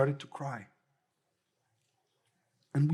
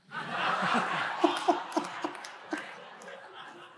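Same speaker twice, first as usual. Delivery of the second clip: high breathy laugh; 12 semitones higher, 4 dB quieter than usual. Real, not acted. Laughs after grandpa punchline.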